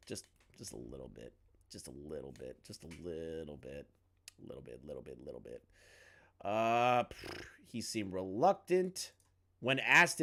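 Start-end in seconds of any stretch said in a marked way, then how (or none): 0.1-5.6 very low, soft repeated mutter; hushed aside. Real, not acted. Streamer hinting at tainted news to come, hushed aside. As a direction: trail into a tiny, teasing undertone.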